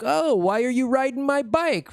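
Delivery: mock schoolyard challenge; schoolyard bully. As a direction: accuse like a playground bully. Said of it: "just like a schoolyard bully".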